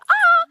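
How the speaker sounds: sudden, very high and loud shriek of delight; excited shriek. Retold or imitated. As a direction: squeal at millions of ladybugs. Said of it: "I'm like".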